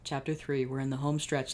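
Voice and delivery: normal talking voice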